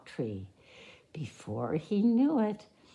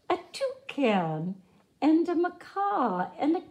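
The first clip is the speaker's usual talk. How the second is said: ordinary delivery, nothing marked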